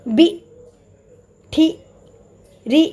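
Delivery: बी, ठी, री with the vowel stretched out; drawn-out vowels. Shown as a demonstration. Teacher drilling stretched syllables for the children. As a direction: pull each syllable long like a chant. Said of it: "उसको खींच के करेंगे".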